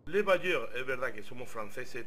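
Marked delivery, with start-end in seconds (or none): 0.1-0.7 put-on French accent. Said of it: "tenemos que hablar con acento francés".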